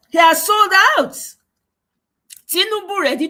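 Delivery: high, loud accusation; loud outraged exclamation. Real, not acted. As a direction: cry it out in outrage. Outraged at Tinubu selling out.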